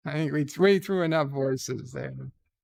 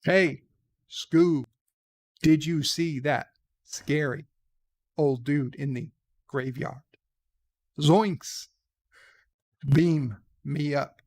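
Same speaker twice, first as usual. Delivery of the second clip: lightly voiced halting starship captain with dramatic pauses; Captain Kirk impression. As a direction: break the line into grand pauses like a TV captain. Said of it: "Or maybe Captain Kirk could do it".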